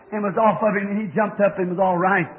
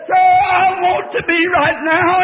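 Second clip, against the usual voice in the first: high, loud, slow pleading; dying woman cries to the preacher, desperate and loud; desperate cry; 8 semitones higher, 8 dB louder, slower than usual. Retold or imitated. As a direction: cry out for salvation before dying.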